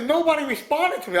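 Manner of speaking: high-pitched imitated protest; Mister T complaining. Star telling a fan nobody answered, high imitated protest. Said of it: "He goes, he goes".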